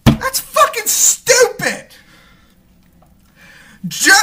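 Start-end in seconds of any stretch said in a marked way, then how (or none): 0.1-1.9 high, slow, incredulous cry; stretched-out exasperated yell; exasperated outburst. Real, not acted. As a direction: throw it up in disbelief.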